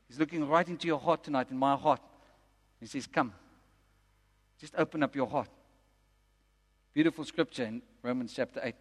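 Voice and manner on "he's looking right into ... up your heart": soft, low, gentle appeal; hushed, low pastoral invitation; tender invitation. Real, not acted. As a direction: invite them softly, voice dropped low.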